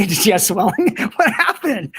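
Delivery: giggling through the worried questions; laughing questions. Retold or imitated. Retelling her questions to the doctor, laughing through them. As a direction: ask while laughing at the absurdity. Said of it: "And I was like".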